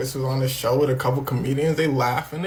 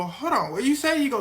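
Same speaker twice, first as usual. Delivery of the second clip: ordinary talk, nothing marked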